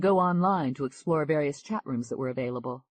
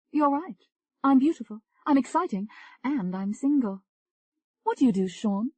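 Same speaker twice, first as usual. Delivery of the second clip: higher, lively English woman; higher bubbly chat voice; Tony, flirty; 9 semitones higher, 3 dB louder than usual.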